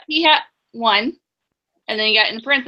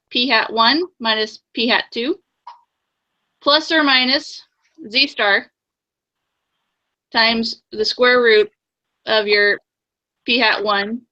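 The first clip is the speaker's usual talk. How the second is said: dictating. Shown as a demonstration. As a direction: spell out each term deliberately. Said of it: "can you read that back to me".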